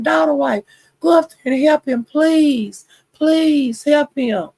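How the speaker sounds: high-pitched appeal, drawn out at the end; high, insistent plea for help; retold pleading. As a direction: imitate yourself begging someone to step in. Retelling her plea to Jackie.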